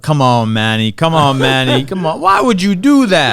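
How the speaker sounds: loud heckling from the crowd, rising to a pleading cry; mock heckling. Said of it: "I talk like".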